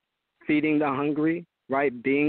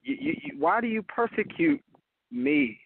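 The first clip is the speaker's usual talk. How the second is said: higher-pitched, pressing questions; Jesus confronting Paul, in a raised voice; voicing Jesus; 7 semitones higher than usual. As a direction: pitch it up and press him with why. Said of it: "He said".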